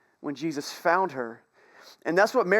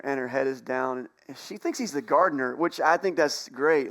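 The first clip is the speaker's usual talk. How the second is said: ordinary talk, nothing marked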